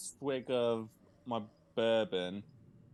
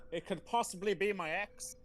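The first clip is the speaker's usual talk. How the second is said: mock shout back; 8 semitones higher than usual. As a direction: call back without really raising the volume. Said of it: "I shout back".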